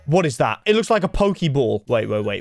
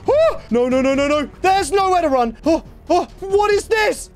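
high frantic cries; terrified with nowhere to run; panicked shrieking; 12 semitones higher than usual. Real, not acted. Trapped with monsters.

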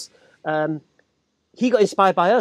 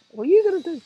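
high, taunting dare; defiant challenge; 11 semitones higher, faster than usual. Retold or imitated. Stallholder daring the returning lad, high defiant challenge. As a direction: square up and dare him to try.

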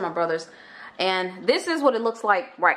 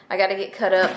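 ordinary talk

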